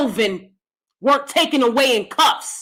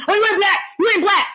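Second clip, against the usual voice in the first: mock accusation; 7 semitones higher, faster than usual. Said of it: "all of a sudden".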